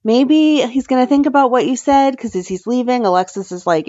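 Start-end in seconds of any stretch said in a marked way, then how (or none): 0.0-2.2 pat her on the head in a high put-on voice; mock reassurance. Gregory consoles the lawyer, high hammed-up comfort. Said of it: "Gregory's like".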